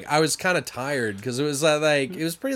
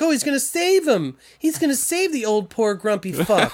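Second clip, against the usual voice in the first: hopeful viewer mock; 7 semitones higher than usual. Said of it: "And you're like".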